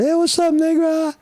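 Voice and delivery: very high, loud falsetto greeting voice; shrill mocking hello in falsetto; mock street greeting. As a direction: hail a buddy in a squeaky high voice. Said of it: "it's like the N-word".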